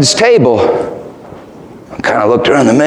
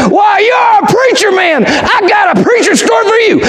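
put-on excited old man. Old man thrilled to meet a preacher, high excited shout. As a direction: burst out with glee at the news. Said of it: "the old guy lit up and he said".

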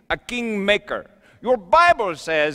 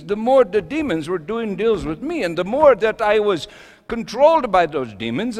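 normal talking voice

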